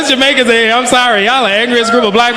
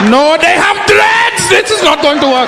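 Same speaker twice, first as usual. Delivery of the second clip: higher, slow panicked shout; panicked hijacker; 5 semitones higher, slower than usual. Retold or imitated. Hijackers realising the flight is wrong, panicked shout. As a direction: panic at the dreadlocks. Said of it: "they be like".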